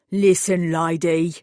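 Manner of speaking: a burly East End mechanic taking over; East End accent. Acted. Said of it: "distinctly East End accent".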